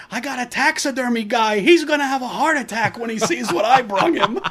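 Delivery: high, loud mock yell with a laugh; Quint yelling. Quint yelling down from the boat, hammed-up and laughing. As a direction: yell it down like a crazed sea captain.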